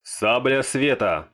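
shouted spell name. Acted. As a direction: chant the spell name in turn. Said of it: "Маги прокричали название заклинания". (выкрикнутое название заклинания)

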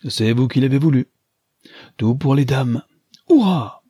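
bold exclamation. A narrator's reading. Rider calling to the ladies as he spurs his horse, exclaiming boldly. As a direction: launch into it, peaking on a high, loud cheer. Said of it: "s'écria-t-il en enlevant son cheval".

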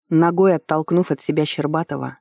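usual speaking voice

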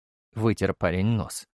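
ordinary delivery, nothing marked

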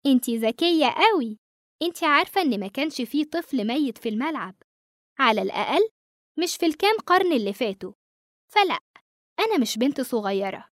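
sly and amused, bargaining lightly; ghost girl voice. Acted.

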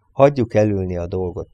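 ordinary delivery

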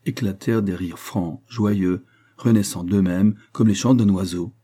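plain reading voice

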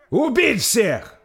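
very slow, loud, high roar. Acted. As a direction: roar the kill order. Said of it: "взревел принц".

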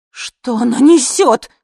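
high, loud, slow squeal; drawn-out indignant squeal; shrieked question. A narrator's reading. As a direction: shriek it in disbelief. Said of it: "взвизгнула жена".

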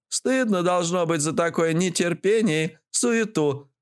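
высокий голос поучающей старухи; отчитай за нетерпение высоким бабкиным голосом; строгая бабуля. A narrator's reading. (бабка стыдит нетерпеливого, голос высокий)

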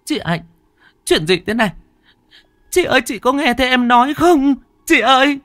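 high, loud desperate wail; wailing shout. Acted. Thúy wailing at her sister who cannot hear her. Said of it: "Thúy gào lên".

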